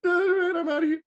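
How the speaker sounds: high, squeaky embarrassed sign-off; flustered exit. Retold or imitated. Starstruck fan hurrying off after the photo, high and flustered. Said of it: "Fanboyed out too hard".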